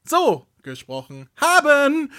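as if pasted from clips; mock spliced speech. (langsam, viel höher, abgehackt wie geschnitten)

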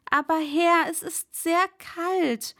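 pleading servant. Acted. Servant begging his master not to send him back out, pleading high and loud. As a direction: beg not to go out in the cold.

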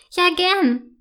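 high, cheerful agreement; happy. A narrator's reading. Girl happily agrees to visit again. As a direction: gladly accept the invitation. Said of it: "freut sich Yvonne".

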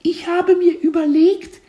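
very high, squeaky little voice; cartoon kid voice. A narrator's reading. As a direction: speak like a cheeky tiny caterpillar. Caterpillar answers teacher.

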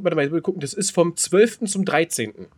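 ordinary talk, nothing marked

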